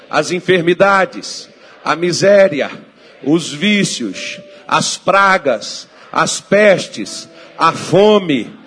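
loud, slow phrases with pauses between; fervent preacher's prayer, building to a shout; full-force prayer. Real, not acted. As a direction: lead the congregation line by line, forcefully. Leads congregation in prayer.

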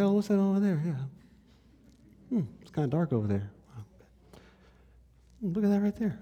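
high, soft voice, idly curious; lightly wondering aloud, in no hurry at all; unhurried Jesus. Acted. Preacher voices Jesus strolling through the dark, unhurried. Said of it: "He's not in a hurry".

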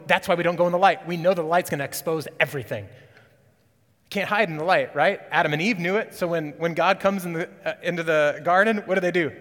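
loud, high and fast, driving the point; full-force preaching. Acted. Preacher urging church out of hiding, loud and quick.

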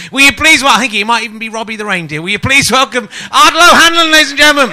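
host's introduction. Acted. Host introducing the next guest.

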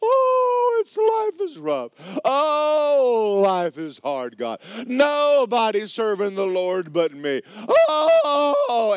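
high, loud whiny complaint; whining Elijah. Acted. Preacher acting out a moaning Elijah to the church. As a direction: wail like a sulking prophet.

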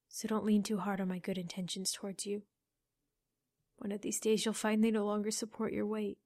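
soft, bitter and cold; quiet bitter echo. A narrator's reading. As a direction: turn his words back on him quietly. Liliana throws his words back.

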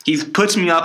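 ordinary talk

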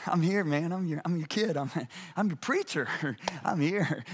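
low, soft, chuckling buddy talk; mock over-casual greeting. Isaiah greeting God like a pal, mock casual. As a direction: toss it off chummily with little laughs.

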